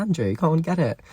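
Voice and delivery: high, pointed mock-innocent offer; high sassy dig at a cheating boyfriend; sassy retelling. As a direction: needle him with a sweet jab. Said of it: "Did feel quite sassy".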